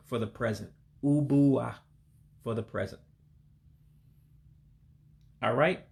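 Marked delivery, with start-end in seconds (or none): 1.0-1.7 "Ubua" as oo-B-ah, with an English B; English-style B. Shown as a demonstration. Teacher repeating the Igbo word for learners, pronounced with an English B. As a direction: demonstrate "Ubua" clearly, plain B for GB. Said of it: "a B sound for us English speakers".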